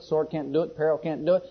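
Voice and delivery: ordinary talk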